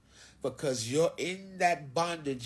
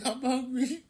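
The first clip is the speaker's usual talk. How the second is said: high, giggling through the words; laughing; 9 semitones higher than usual. Real, not acted. Telling viewers he's hungry, laughing high. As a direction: laugh it out, tickled. Repeats line, second laughing.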